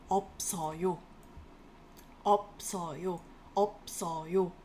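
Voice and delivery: normal talking voice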